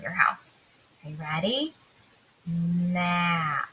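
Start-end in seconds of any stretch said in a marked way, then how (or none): 2.5-3.7 stretched map; slow blend. Shown as a demonstration. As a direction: draw it out slowly. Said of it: "a little bit faster each time".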